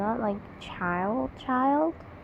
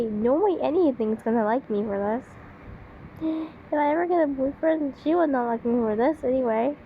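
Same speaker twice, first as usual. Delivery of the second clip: ordinary talk, nothing marked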